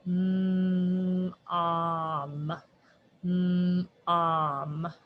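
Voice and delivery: "Mm, um" stretched sound by sound; sounding out words. Shown as a demonstration. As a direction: hum out "Mm" then "um" slowly. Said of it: "Let's sound out mom".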